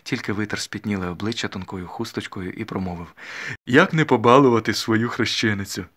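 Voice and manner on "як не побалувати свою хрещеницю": put-on loud, indulgent old gentleman; doting godfather, a touch tipsy and moved; moved old uncle. A narrator's reading. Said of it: "А дядя і сам розчулився".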